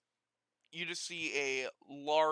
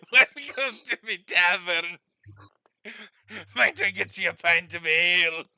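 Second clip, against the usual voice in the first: Scottish dwarf; 3 semitones higher, 11 dB louder than usual. Acted. Tavern keeper offering ale, loud Scottish dwarf. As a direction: welcome them loudly in a hammed-up Scottish accent. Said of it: "he's a Scottish dwarf".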